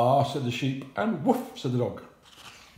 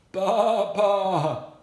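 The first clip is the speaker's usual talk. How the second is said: high, loud sheep bleat; bleating baa; 7 semitones higher, 6 dB louder than usual. Acted. Hen fooling the thieves, loud bleating baa.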